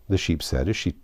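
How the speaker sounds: usual speaking voice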